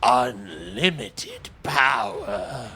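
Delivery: slow, hammed-up Palpatine menace; dramatic villain voice. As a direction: drag it out like an evil emperor. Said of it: "The way he said that".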